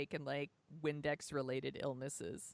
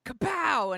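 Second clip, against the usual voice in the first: kapow; 8 semitones higher, 9 dB louder than usual. Retold or imitated. Bridesmaid dress reveal, sound effect.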